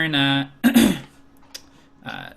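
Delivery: ordinary talk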